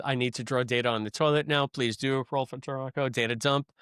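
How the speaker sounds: usual speaking voice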